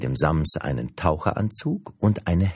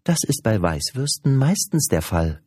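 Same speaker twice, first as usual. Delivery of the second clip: lightly amused, higher-pitched reply; mildly tickled saleswoman; 6 semitones higher, 3 dB louder than usual. A narrator's reading. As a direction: answer with a touch of amusement. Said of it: "sagte die Verkäuferin lachend".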